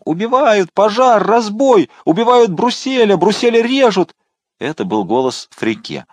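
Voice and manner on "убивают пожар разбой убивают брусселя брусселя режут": shrill falsetto, much higher and louder; falsetto scream. A narrator's reading. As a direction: howl murder from the attic. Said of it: "завопил фальцетом". (вопль фальцетом)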